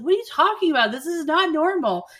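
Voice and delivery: high, loud incredulous mock outrage; incredulous Bridget. Bridget to Mark barging in, mock incredulous. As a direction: throw the question back in disbelief. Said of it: "And Bridget's like".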